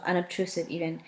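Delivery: usual speaking voice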